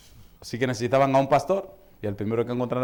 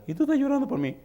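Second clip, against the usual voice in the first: old mother voiced; 13 semitones higher than usual.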